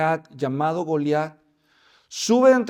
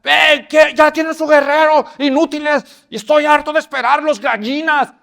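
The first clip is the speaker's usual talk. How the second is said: high, very loud mocking shout; the giant jeering at the army; giant's shouted taunt; 9 semitones higher, 10 dB louder than usual. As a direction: yell the taunt at the cowards. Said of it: "empieza a oír la gritadera".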